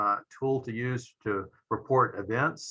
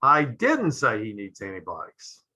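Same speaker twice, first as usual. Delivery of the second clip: didn't hit hard and falling; stress on didn't. Shown as a demonstration. Lecturer showing how stress shifts meaning, stress on didn't. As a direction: punch didn't as a flat denial. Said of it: "notice how the meaning changes depending upon the inflection".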